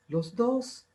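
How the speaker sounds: lightly voiced timid child; shy student. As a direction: play a shy kid answering from the back. Quiet student voice.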